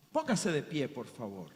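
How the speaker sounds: soft, low closing invitation; gentle altar call. Real, not acted. Preacher asking the congregation to stand, soft and low. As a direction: invite them gently, leaving long pauses.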